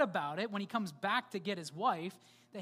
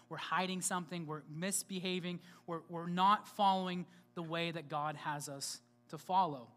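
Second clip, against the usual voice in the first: ordinary talk, nothing marked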